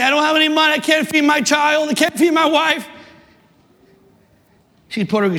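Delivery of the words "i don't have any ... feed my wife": mock lament. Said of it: "I could cry all day".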